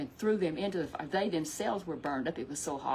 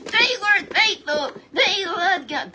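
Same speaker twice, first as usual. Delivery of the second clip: high loud praise; 12 semitones higher, 11 dB louder, slower than usual. A narrator's reading. Storyteller praising the boys.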